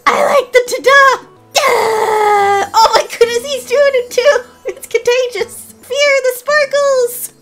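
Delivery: high-pitched, loud delighted outburst; real giddy excitement, voice way up high; excited squealing. Real, not acted. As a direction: squeal with sheer delight at the discovery. Delighted reaction to new emote.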